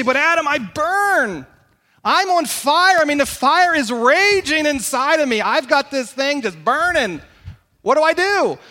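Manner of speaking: loud, high, urgent plea; acted desperation. Congregant pleading with the preacher for an answer, desperate and loud. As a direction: cry out as someone consumed, drawing out the key words. Voicing a congregant burning with desire.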